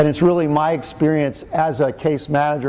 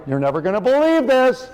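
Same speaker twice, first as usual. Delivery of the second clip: high-pitched mock disbelief; father's catchphrase; 10 semitones higher than usual. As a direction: echo dad's exclamation, pitched way up. Repeats father's catchphrase to the audience.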